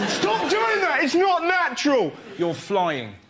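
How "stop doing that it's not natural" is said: high-pitched hammed-up yell of complaint; outraged mock shout. Passenger objecting to the kissing couple on a plane, outraged mock shout. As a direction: shout it very high, as a put-on protest.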